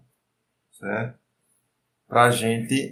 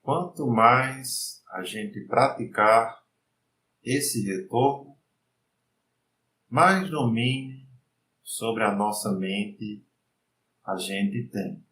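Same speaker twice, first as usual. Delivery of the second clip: slow, soft meditation voice; guided meditation; 7 dB quieter, slower than usual. Acted. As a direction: lead the mind calmly, unhurried.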